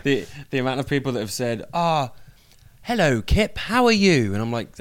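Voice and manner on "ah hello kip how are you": high, hammed-up London accent; mock a bad old-school London greeting, pitched well up. Guest mimics fans greeting him, in mock old school London accent. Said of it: "old school London accent".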